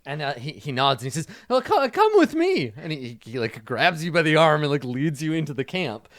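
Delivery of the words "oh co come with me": high, loud, fussy reassurance; high-pitched, hurried character voice; skeleton illusionist. Acted. Game master voices Fezzik.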